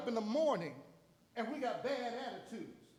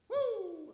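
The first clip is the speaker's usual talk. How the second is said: joyful woo; 13 semitones higher than usual. Real, not acted. Joyful exclamation.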